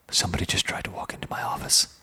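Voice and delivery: hushed so the visitor won't hear; whisper. Real, not acted. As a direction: drop to a breathy whisper. Someone at the office door.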